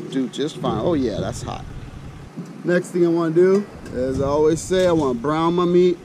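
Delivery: ordinary delivery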